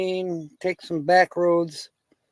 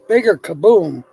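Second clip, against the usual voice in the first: louder kaboom, blown up for the joke; mock bigger explosion; 6 dB louder than usual. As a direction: land the bigger blast.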